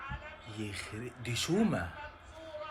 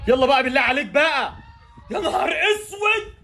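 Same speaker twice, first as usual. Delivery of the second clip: very high, loud pleading cry; desperate shout; 10 semitones higher, 6 dB louder than usual. Real, not acted. Fan begging Taher to finish, desperate shout. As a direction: beg and then despair.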